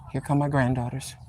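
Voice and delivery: hushed whisper as they pass; secretive whisper. Real, not acted. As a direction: whisper it so they can't hear. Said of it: "unvoiced whisper".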